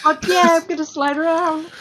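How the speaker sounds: high, giddy cheer; gleeful. Pretend. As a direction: cheer like an excited walrus. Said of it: "is just like".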